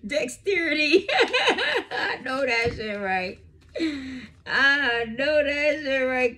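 high, loud, slow laughing words; real laughter, slow and high; laughing hard. Real, not acted. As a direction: squeeze words out through laughter. Laughing at reading.

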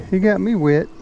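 normal talking voice

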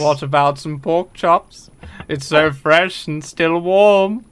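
low, slow, drawn-out cartoon voice; low impression, unhurried and deliberate; Mister Popo. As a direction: say it low and slow, stretching the ends. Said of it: "in the voice of Mister Popo from D B Z Abridged".

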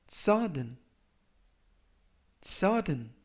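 "sudden" spoken clearly as SUD-n, with the stress on the first syllable and a falling close; careful pronunciation demo. Shown as a demonstration. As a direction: model "sudden" for learners, falling tune. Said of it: "이라고 발음하시면 되겠고요". ("sudden" 또박또박 발음 시범)

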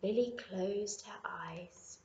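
slow whispered narration; whispering. Acted. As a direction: whisper her to sleep. Falling asleep narration.

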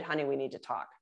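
hushed dread. Retold or imitated. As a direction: drop it low like a warning. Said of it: "the dreaded".

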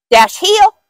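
sharp high command to a dog; firm dog commands. Acted. Handler commanding dog to heel.

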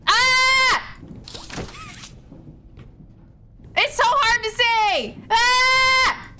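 terrified gamer yelling at the monsters; panicked screaming. Real, not acted. Hunted by monsters in game.

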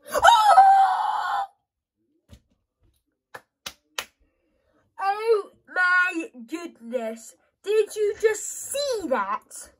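very high, slow, drawn-out shout; amazed celebration. Real, not acted. Gamer stunned by a goal, high drawn-out shout. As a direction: gasp and shout in disbelief.

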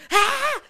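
high, loud mock wail; imitated baby cry. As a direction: burst out like a startled crying toddler. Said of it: "começou a chorar". (choro de bebê imitado)

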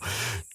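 a short gentle chuckle; soft chuckle. Acted. Giant soothing a nervous tiny human, soft chuckle. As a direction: chuckle softly to put them at ease.

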